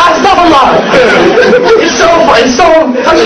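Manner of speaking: high-pitched words squeezed through laughter; laughing. Real, not acted. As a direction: struggle to talk while cracking up. Still laughing at his story.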